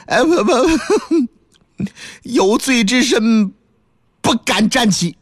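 slow, shaky scared refusal; too scared to stand up; trembling refusal. Acted. Terrified Xu Xuan refuses to stand. (吓得发抖地推辞)

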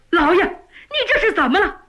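put-on a servant boy blurting out his shock; alarmed servant. Servant boy kneeling before his condemned master, alarmed cry.

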